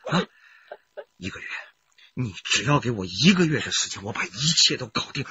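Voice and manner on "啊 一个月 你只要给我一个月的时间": normal talking voice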